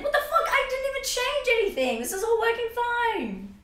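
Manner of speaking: high, laughing outrage; exasperated disbelief. Real, not acted. Streamer baffled that audio broke, high laughing disbelief. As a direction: squeal it in baffled frustration.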